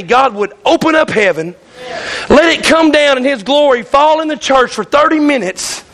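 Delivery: ordinary talk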